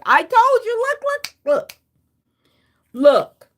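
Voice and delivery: high, eager, look look look; excited told-you-so. Real, not acted. Thrilled to show viewers the card matches, high and eager. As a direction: jump up with delight, pointing at it.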